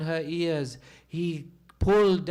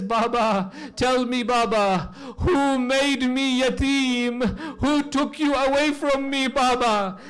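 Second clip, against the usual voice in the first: put-on grieving child's cry; 8 semitones higher, 7 dB louder than usual. Sakina pleading with her father's head, high wailing cry.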